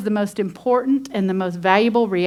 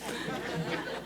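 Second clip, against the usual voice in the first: breathy laugh; 17 dB quieter than usual. Real, not acted. Laughs at own impression.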